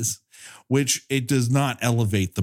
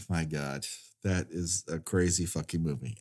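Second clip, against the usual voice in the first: much lower and softer; quiet; 6 semitones lower, 9 dB quieter than usual. Real, not acted. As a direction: mutter in stunned disbelief.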